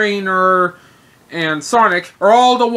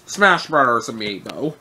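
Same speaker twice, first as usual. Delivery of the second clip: usual speaking voice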